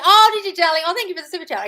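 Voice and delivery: high, loud delighted coo; gushing thanks. Real, not acted. Host thanking a viewer for a super chat, gushing.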